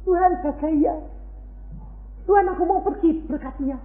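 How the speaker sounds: high put-on voice giving God orders; mock bossy prayer. Believer orders God before going out, high mocking. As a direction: parody a demanding prayer. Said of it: "mau perintah-perintah itu gitu?".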